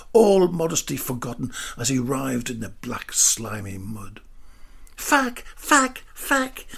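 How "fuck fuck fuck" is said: exasperated shout. A narrator's reading. Des stuck in the mud shouts in exasperation. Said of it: "he shouted in exasperation".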